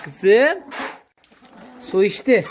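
high, loud sweet-talk to the cow; talking to a pet. Real, not acted. Coos at the calf. (hayvana sevgiyle seslenen yüksek ses)

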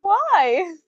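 high, loud, dismayed questioning; shrieked demand for an explanation; upset outcry. Acted. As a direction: cry out in disbelief. Upset at item thrown away.

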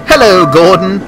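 high, loud cheerful hello; happy greeting. A narrator's reading. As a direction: call out gladly to a friend. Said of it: "whistled Scott happily".

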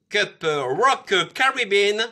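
slow, drawn-out English accent on Rock Caribbean. Shown as a demonstration. Showing off his English accent to viewers, slow and drawn-out. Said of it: "vous avez vu l'accent".

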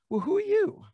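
very high, mock-incredulous question; disdainful challenge. Priest challenging the unwelcome prophet, disdainful. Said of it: "they don't like what they hear".

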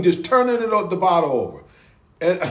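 usual speaking voice

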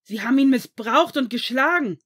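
high, very loud accusation; furious shout. A narrator's reading. Severus accuses the relatives before the headmaster, shouting. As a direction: yell it out in fury. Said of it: "schrie er".

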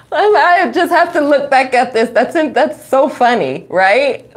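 talking through real laughter; laughing. Real, not acted. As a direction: laugh while you speak. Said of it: "laugh cry".

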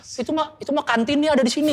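lightly voiced roasting MC jab; mock roast line. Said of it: "Guru tuh dicengin fisik semua".

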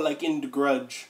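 ordinary talk

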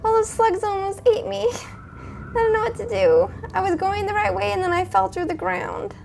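high, small frightened voice; small scared voice. Real, not acted. Gamer after nearly being eaten, high scared voice.

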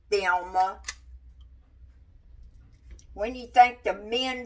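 put-on loud, drawling older Southern lady; old country lady. Cook calling to Thelma about the menfolk, in a loud old-lady voice. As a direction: channel the old ladies calling from the kitchen. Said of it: "that's the way they talked".

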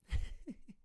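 high breathy giggle; a sly little laugh; mouse giggle. Acted. Mouse laughing as fox runs away, a high giggle.

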